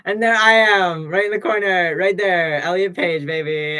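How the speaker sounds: loud, high burst of hype; excited shout. Real, not acted. Streamer yelling at the screen, loud and high. Hyped shout over a photo.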